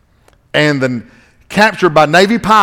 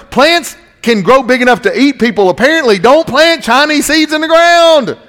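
high, urgent mock warning; rising to an exasperated high-pitched cry; alarmed preaching; 7 semitones higher than usual. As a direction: warn the congregation in comic alarm.

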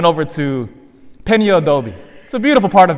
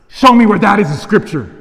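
challenging demand; 3 semitones higher, 4 dB louder than usual. Retold or imitated.

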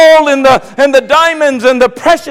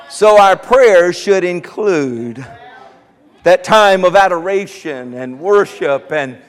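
usual speaking voice

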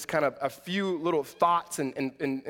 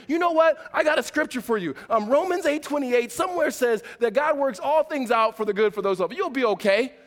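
higher, louder, hammed-up pious cheer; mock preachy comfort; 7 semitones higher, 4 dB louder than usual. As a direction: brush off the sad person with a loud, preachy verse.

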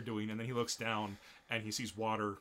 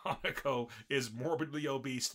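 ordinary talk

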